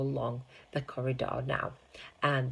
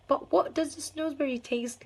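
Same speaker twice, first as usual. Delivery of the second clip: put-on child's question; 13 semitones higher than usual. Child voice asks Wonka.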